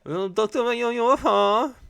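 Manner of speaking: "abducted by a U F O" squeaked high and loud; mock squeal of "abducted by a U F O"; high squeaky repeat. Shown as a demonstration. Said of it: "is abducted by a U F O".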